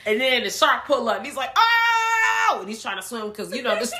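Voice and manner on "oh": a sudden high, loud yelp of fright; terrified yelp. Retold or imitated. Comic book man spotting the shark, shrieking in fright.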